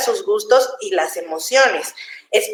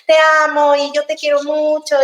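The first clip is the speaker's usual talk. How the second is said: put-on higher, sweet little-kid voice; gushing child; 5 semitones higher than usual. A child freely telling a parent it loves them, higher and sweet. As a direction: gush it out like a small child hugging a parent. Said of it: "Los niños solitos se sueltan".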